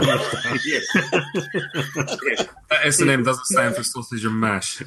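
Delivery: chuckling through the words; laughing. Real, not acted. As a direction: laugh while you say it. Joke amid table laughter.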